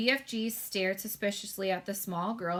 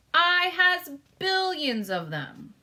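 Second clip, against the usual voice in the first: higher, louder, slow giant brag; proud BFG boast; 10 semitones higher, 7 dB louder, slower than usual. A narrator's reading. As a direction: show off the bottles grandly.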